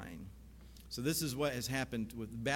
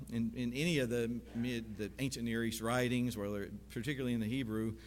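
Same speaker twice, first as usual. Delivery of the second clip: ordinary talk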